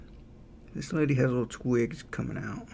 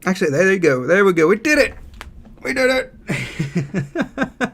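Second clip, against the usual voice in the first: higher and louder, real delight at success; excited cheer; 7 semitones higher, 8 dB louder than usual. Real, not acted. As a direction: burst out happy that it finally worked. Streamer succeeds at the bird.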